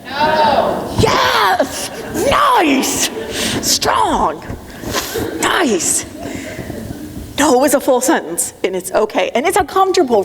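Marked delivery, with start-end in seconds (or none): 0.0-1.0 a strong, clipped refusal; the crowd's firm no. Pretend. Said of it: "And you say to me". 1.0-6.0 high, delighted praise; bursting with praise; excited cheering. Real, not acted. Cheering audience's no.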